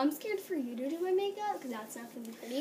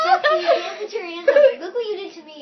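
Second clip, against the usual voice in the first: much louder half-crying protest; loud outraged cry; 7 semitones higher, 20 dB louder than usual. Real, not acted. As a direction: wail it out in outrage. Friend ruins her face.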